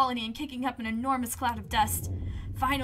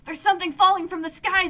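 raised, higher alarmed call; shouting; 6 semitones higher, 9 dB louder than usual. A narrator's reading. Colonists spotting the falling object, shouting. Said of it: "they shout".

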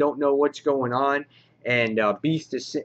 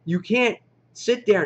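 much higher, insistent, pushing them out; imitating a pushy heroine barking orders; mock-forceful warning, pitched up high; 10 semitones higher, 3 dB louder than usual. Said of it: "She's so forceful".

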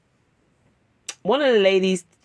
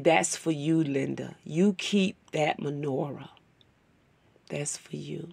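tender insistence; 4 semitones lower, 8 dB quieter than usual. Retold or imitated. Boutique owner insisting Linda keep the menorah, soft and tender. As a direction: lay each word down gently. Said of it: "the way she said it meant so much".

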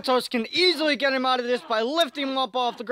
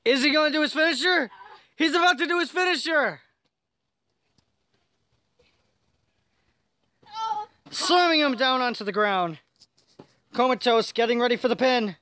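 excited commentator; 6 semitones higher than usual. Pretend. Commentator building to the pin.

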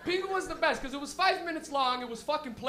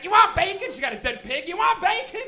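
high, loud crazed offer; crazy grandma; 6 semitones higher, 9 dB louder than usual. Retold or imitated. Arnold's crazy grandma offering bacon, high and loud. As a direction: shout it like an unhinged grandma. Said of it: "His grandma would be like".

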